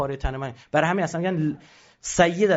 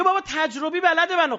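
mock boast; 11 semitones higher than usual. Amateur brags about his skill.

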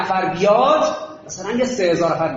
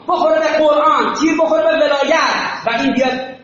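shouted sermon, higher and louder throughout; fiery preaching; 7 semitones higher, 4 dB louder than usual. Acted. Preacher rousing the crowd to shield Islam, full-force shout. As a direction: hammer each line home like a preacher at full force.

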